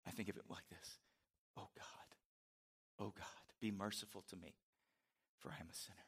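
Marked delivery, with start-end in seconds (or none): 1.6-6.0 humble contrite prayer. Acted.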